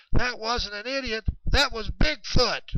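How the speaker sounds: loud, high-pitched insistence; excited. Retold or imitated. DJ correcting Chet about the idiot, loud and excited. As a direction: burst out with it, emphatic and high.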